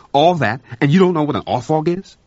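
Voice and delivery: exasperated. A narrator's reading. McNaught exasperated with cook.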